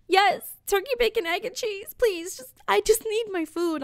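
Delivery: high, desperate repeat of the order; exasperated pleading. Retold or imitated. Hungry customer pleading with the deli guy, high exasperation.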